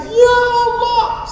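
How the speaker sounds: very high, drawn-out imitation wail; mock weeping. Pilgrims seeing the Kaaba all cry, mock weeping. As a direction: wail it slowly like an overcome pilgrim. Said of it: "satu rombongan nangis".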